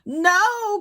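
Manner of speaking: high, loud cry of despair; husband crying out at terrible news; anguished cry. A narrator's reading. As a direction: cry it out in shock. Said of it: "cried Henry".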